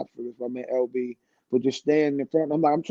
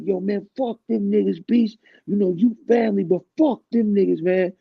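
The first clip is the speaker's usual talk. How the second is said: higher hyped-up voice; 6 semitones higher than usual. Retold or imitated. Director's man egging Beast on.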